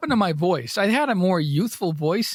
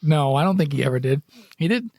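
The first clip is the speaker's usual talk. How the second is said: ordinary talk, nothing marked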